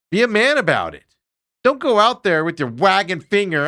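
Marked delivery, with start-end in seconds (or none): none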